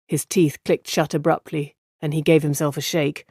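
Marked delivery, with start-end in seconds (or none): none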